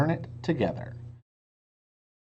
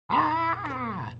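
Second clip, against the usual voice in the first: high shrill-free scream of fright, pitch way up; a startled scream at a flying monster; 12 semitones higher than usual. Acted. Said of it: "screamed Annie".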